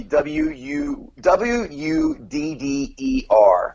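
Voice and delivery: slow letter-by-letter spelling. Shown as a demonstration. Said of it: "If you were to spell it".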